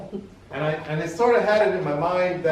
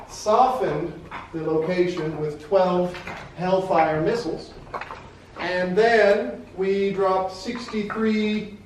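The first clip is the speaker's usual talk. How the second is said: laid-back surfer dude, slow and higher; 6 semitones higher, 3 dB louder, slower than usual. Retold or imitated. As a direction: drawl it like a chill surfer. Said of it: "he had a voice a bit like a surfer dude".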